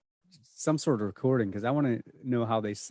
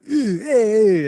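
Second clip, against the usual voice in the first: loud, high Brooklyn-style "hey"; mock loud interjection; real loud; 8 semitones higher, 11 dB louder than usual. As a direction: holler it like a brash local. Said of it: "real loudly".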